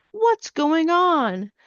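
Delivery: high, drawn-out storybook puzzlement; puzzled exclamation. Acted. Reading a child's reaction to the wild meter, puzzled exclamation. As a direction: read it as a slow, high-pitched question of alarm. Voicing surprised children in the comic.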